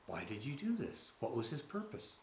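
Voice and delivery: softly spoken sincere inquiry; gentle, unaccusing questioning; honest, simple question. Retold or imitated. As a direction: ask it quietly, only wanting to understand. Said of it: "it was an honest question".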